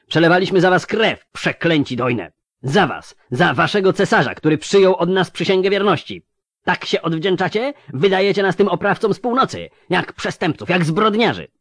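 high, loud bitter outcry; outraged shout. Acted. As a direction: rage at the betrayal. Betrayed elf officer rages.

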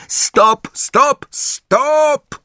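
very high repeated yell; shouted halt. A narrator's reading. Angelina halting practice, shouting very high. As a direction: cry it out, stretching the last one. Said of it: "krzyknęła Angelina".

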